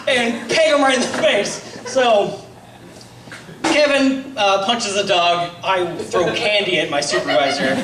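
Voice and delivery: usual speaking voice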